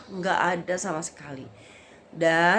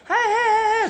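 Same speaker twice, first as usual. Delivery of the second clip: high, loud mock holler; hammed-up high shout; imitated yelling; 8 dB louder than usual. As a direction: act out men yelling to stop someone. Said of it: "teriak-teriak".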